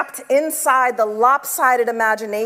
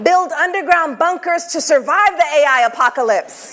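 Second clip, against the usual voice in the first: high, punchy prompt; emphatic call-out; 5 semitones higher, 4 dB louder than usual. Acted. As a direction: call it out to the room. Prompting audience call and response.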